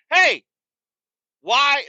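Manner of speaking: high, loud acted holler; exasperated shout. Yelling back at the choking guy, exasperated shout. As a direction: call out sharply to stop someone, in retelling.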